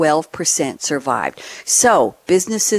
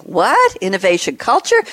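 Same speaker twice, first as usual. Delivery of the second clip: higher-pitched acted incredulity; mock disbelief; 3 semitones higher than usual. Host imagines a listener questioning innovation culture, in mock disbelief. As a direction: put on a baffled listener's voice, pitched up. Voices a baffled sceptical listener.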